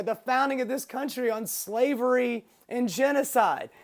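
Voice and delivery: usual speaking voice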